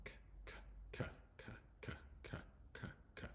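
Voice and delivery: "k" as a soft voiceless click of air, repeated; short, separate "k" sounds, not the letter name; isolated K sound. Shown as a demonstration. Teacher showing the straightforward K sound, isolated and repeated. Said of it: "The K is straightforward sound".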